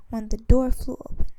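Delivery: ordinary delivery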